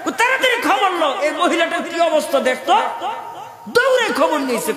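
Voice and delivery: high-pitched, dramatised sermon storytelling; animated preaching. Acted. Preacher retelling the king urgently ordering news, high animated delivery.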